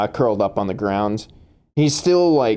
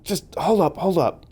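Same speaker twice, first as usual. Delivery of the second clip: pleading; 8 semitones higher, 3 dB quieter than usual. Acted. Beaten intruder pleads with the player.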